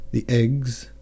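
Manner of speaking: a touch of tearful joy, voice lifting on the eggs; old father mumbling, moved almost to tears; muttered through tears. A narrator's reading. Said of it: "muttered the Count through tears of joy".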